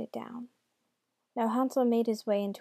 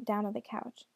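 plain reading voice

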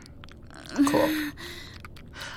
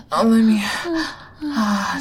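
usual speaking voice

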